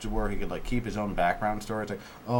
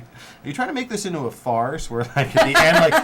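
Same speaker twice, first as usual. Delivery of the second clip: incredulous; 10 dB louder than usual. Acted. Mocking the script.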